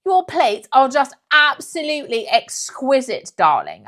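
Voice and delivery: loud, slow, high mock-posh gushing; imitating a pseudo-posh dinner guest; pseudo-posh accent. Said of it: "Because she talks like that".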